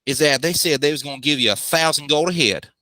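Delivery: put-on a clear Australian accent back on for the clerk; Aussie accent. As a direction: Helmet the clerk explains the contract in Aussie. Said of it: "that Aussie accent".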